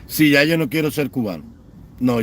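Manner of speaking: ordinary delivery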